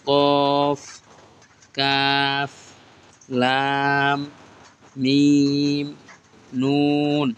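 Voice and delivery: letter names stretched long, one by one; slow, stretched Arabic letter names; drawn-out recitation. Shown as a demonstration. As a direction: draw out each letter name like a Quran teacher. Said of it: "প্রত্যেকটা কিন্তু এই লাইনে টান আছে".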